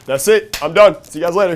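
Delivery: loud, high-pitched sign-off; triumphant exit announcement. Retold or imitated. George quitting the meeting on a high note, loudly triumphant. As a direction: announce your exit at full volume.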